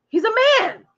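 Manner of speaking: high incredulous exclaim; shocked disbelief; astonished. Real, not acted. Disbelief at son's age.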